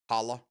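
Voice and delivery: holla with a broad ah; other pronunciation. Shown as a demonstration. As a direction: repeat holla as "HAH-luh". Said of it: "You say holla, don't you?".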